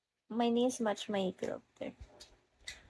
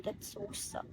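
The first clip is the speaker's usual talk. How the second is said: breathy pretend pout, whispered; whispered mock pout; whispered mock sadness; 10 dB quieter than usual. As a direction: sigh it out like a sulking kid. No gifts, pouts to viewers.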